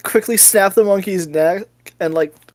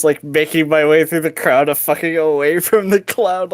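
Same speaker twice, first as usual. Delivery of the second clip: normal talking voice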